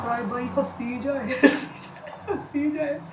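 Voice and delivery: real laughter breaking the words up; can't stop laughing while telling it; laughing through words. Real, not acted. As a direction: giggle through it, pitch up. Laughing while retelling husband's fit.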